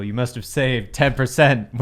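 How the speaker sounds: ordinary talk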